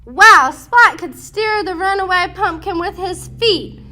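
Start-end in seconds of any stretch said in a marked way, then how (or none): none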